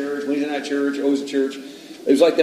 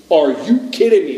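loud, higher mock outrage; exasperated mother; 4 semitones higher, 7 dB louder than usual. Mother answers her snooping son, exasperated mother. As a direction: snap it loudly as a fed-up mom.

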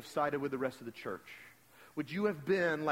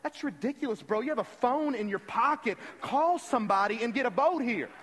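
high, mock-exasperated protest; raised pitch, hammed-up impatience; exasperated inner outburst; 6 semitones higher, 5 dB louder than usual. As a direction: act out silent frustration bursting out, building louder. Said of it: "Inside, I'm going".

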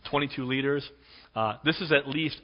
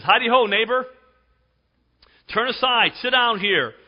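jovial greeting; 8 semitones higher, 7 dB louder than usual. Acted. Boaz greeting kinsman jovially.

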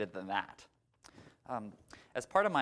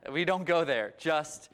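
loud refusal; 4 dB louder than usual. Retold or imitated. Therapist refusing to hear about her father, loud. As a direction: wave her off firmly.

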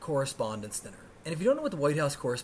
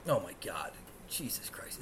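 hushed, unvoiced exasperation; soft, low oath to yourself; whispered dismay; 8 semitones lower, 9 dB quieter than usual. Real, not acted. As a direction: whisper it under your breath in disbelief. Dismay at the Met Gala.

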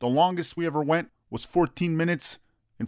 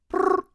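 high trilled phone ring; phone ring noise; 4 dB louder than usual. Shown as a demonstration. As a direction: ring ring with the lips.

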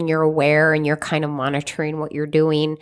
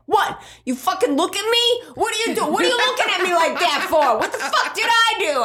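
high, hammed-up outraged yelling; mock Italian fight; 13 semitones higher than usual. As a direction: play the hothead picking a fight over a look, pitched way up. Acted Italian family quarrel in park.

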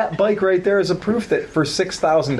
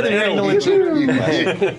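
hammed-up announcer; 9 semitones higher, slower than usual. Jokey bike name announcement.

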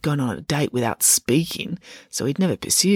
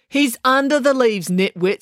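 scathing; 7 semitones higher, 4 dB louder than usual. Acted. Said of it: "said Kiran scathingly".

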